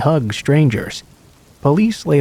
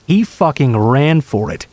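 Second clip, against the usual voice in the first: ordinary delivery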